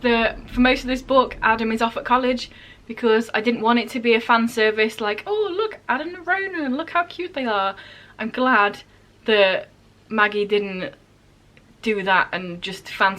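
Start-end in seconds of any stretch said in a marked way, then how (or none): 5.3-7.8 high, soft mock cooing; mock-adoring squeal kept small; gushing fan. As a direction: coo like a smitten fan, pitch sliding down. Mimics gushing fan-service reaction.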